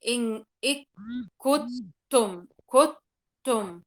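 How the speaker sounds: "إن إك كتم" very slow and loud, nun lost; wrong merged nun. Shown as a demonstration. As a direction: drag out "كتم" with no nun at all, loudly. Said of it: "لا أقول". (نطق خاطئ بطيء جداً لـ"كتم")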